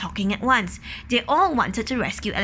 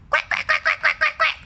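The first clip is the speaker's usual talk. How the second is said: excited quacking. Acted. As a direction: quack up high like a worried duck. Ducks quacking.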